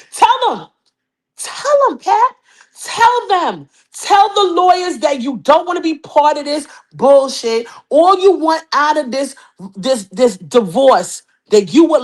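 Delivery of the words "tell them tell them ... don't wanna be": high, laughing urging; excited egging on. Real, not acted. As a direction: cheer him on, giggling. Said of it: "egging Pat on".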